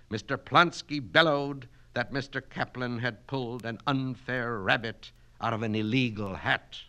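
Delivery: ordinary delivery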